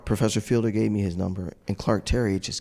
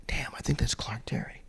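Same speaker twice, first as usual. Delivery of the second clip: hushed, barely voiced realization; hushed awe; 3 semitones higher, 7 dB quieter than usual. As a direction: mutter it softly, as if spotting an idol. Spotting his idol across the diner.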